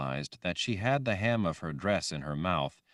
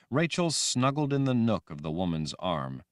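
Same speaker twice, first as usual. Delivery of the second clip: plain reading voice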